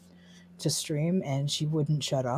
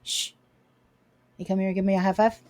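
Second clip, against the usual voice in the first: higher sing-song pet talk; 5 semitones higher, 7 dB louder than usual. Real, not acted. Owner doting on her dog at her desk. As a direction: dote on the dog playfully.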